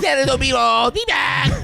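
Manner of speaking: high, goofy shouted voice; Adam Sandler impression. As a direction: do a dopey Sandler yell. Imitating Sandler.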